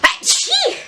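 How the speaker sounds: high squeaky achee sneeze; a loud squeaked sneeze on request; cute sneeze. Shown as a demonstration. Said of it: "I've been asked for achee".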